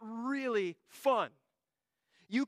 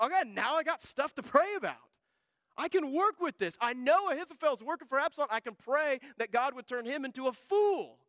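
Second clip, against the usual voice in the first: higher, energized can-do delivery; rally yourself, fired up to pray; acted eagerness, pitched up high; eager resolve; 5 semitones higher, 3 dB louder than usual. Acting David eagerly getting to work.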